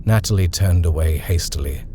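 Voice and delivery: plain reading voice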